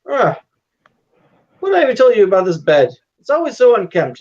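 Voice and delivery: high, loud, fussy scolding; nagging father. Acted. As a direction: play an exasperated dad fussing over untidiness. Father scolds daughter about bed.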